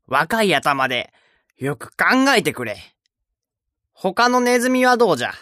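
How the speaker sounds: put-on high, loud frustrated old mouse; irritated shout. A narrator's reading. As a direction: snap at them, impatient. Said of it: "イライラして叫びました". (イライラした叫び声)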